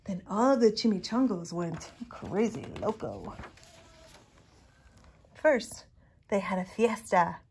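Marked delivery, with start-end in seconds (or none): none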